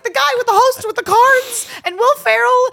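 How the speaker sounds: loud, high, insistent retelling; exasperated fan excitement. Retold or imitated. Explaining the host to unimpressed friends, high excited shouting. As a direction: insist loudly, amazed they don't know him.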